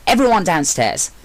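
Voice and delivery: clipped command to the group. A narrator's reading. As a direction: call everyone down with authority. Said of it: "Mello commanded".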